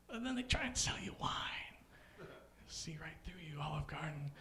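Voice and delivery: soft, breathy whisper; conspiratorial whisper. Acted. Comic whispering to Olive Garden that he sees through it. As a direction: whisper like a secret accusation.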